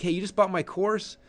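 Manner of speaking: high, bright-eyed hey; excited salesman greeting; upbeat pitch voice. Shown as a demonstration. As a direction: greet the buyer like a friend. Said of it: "You do wanna position it like".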